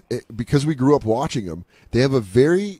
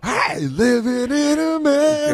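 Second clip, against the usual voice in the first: high, slow, hammed-up showman croon; mock Apollo showman; 14 semitones higher than usual. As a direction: belt it like a flag-waving showman. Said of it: "post that Preacher episode in a big American top hat".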